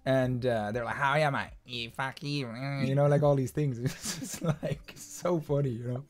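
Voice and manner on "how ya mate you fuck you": rough Australian Bogan imitation; slurred-together Australian Bogan greeting; Bogan accent. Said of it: "We say the Bogan accent".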